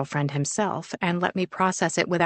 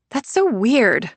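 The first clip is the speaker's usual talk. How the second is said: put-on slow, drawn-out teen voice, whining at the idea; a teen groaning at an awkward plan; 6 semitones higher, 3 dB louder, slower than usual. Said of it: "Sienna groans".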